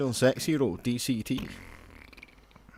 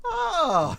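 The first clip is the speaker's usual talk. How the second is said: surprised yelp. Retold or imitated. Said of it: "I was like".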